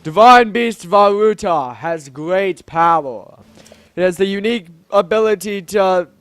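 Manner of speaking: weighty, proclaiming monarch; grand Zora king, explaining. Acted. King explaining the beast's power, grand and stately. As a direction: intone it with regal importance.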